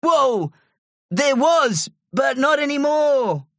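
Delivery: high-pitched calling voice; high character shout. A narrator's reading. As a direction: keep it high, as if shouting down from a treehouse. Terry still calling to the gorilla.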